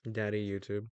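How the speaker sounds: ordinary talk, nothing marked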